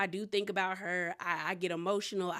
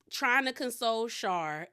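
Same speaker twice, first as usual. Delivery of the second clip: normal talking voice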